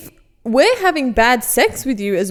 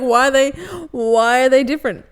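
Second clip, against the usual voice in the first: louder, mock-baffled customer; insistent questioning; 5 dB louder than usual. Imitating a customer confused by shorts prices, insistent questioning. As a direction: press the question, imitating a puzzled shopper. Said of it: "And he's like".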